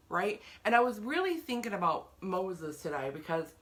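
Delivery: ordinary talk, nothing marked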